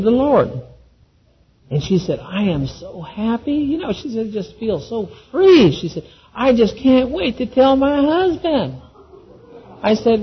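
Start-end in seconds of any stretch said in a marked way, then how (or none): 2.2-8.7 lightly voiced joyful wife. Wife voiced after accepting the Lord.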